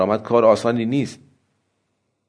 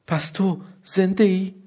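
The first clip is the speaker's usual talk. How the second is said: higher, softer, halting disbelief; stammering; 6 semitones higher, 4 dB quieter than usual. A narrator's reading. Wife calls in shock to her husband in the crocodile, stammering softly. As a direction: gasp it out with a catch in the throat. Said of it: "النا که زبانش به لکنت افتاده بود گفت".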